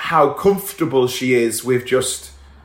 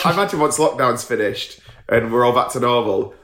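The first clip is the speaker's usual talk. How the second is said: usual speaking voice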